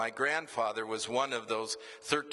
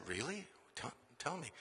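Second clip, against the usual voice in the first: eager hush; 3 semitones higher, 11 dB quieter than usual. Retold or imitated.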